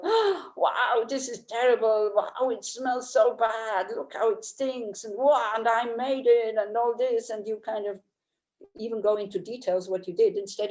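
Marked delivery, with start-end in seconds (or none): 0.0-7.3 mock disgusted amazement. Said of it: "you look at it and go like".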